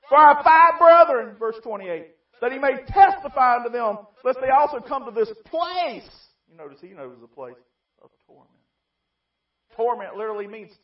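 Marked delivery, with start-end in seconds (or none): 0.1-6.3 high, loud, rising cry; rich man's desperate plea. Acted. Rich man in hell begging Abraham to warn his family, high shout. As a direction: beg with a shout growing higher.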